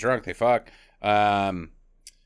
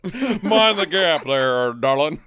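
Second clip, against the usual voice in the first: very slow, louder hammed-up twang; mock twang; 4 semitones higher, 4 dB louder, much slower than usual. Co-host topping the British twang joke, exaggerated drawl. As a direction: draw out a British phrase in a silly twang. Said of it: "The twang".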